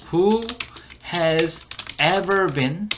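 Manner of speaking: very slow, word-by-word teaching delivery; slow model reading. Shown as a demonstration. Teacher repeats the clip for learners, slow model reading. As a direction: stretch each English word out slowly.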